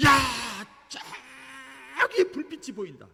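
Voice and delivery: high, loud drawn-out cry of discovery; lookout's stretched-out shout on sighting a light. Retold or imitated. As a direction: holler it slowly across the water. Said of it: "소리를 지르는 거예요".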